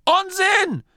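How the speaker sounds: very high, loud dismissal; automatic retort. A narrator's reading. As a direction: snap back in a high granny voice. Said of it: "erwiderte Oma Morky automatisch". (reflexhafter, lauter Widerspruch)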